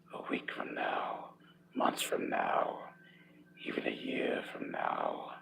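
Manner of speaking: low, slow whisper into the ear; seductive whisper. Acted. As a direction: whisper it like a promise in his ear. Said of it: "he whispered into my ear".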